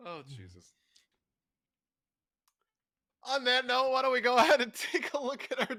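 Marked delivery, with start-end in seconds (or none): 3.2-5.8 high, loud show-host pivot; host announcer voice. Acted. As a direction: announce the segment brightly. Host transition.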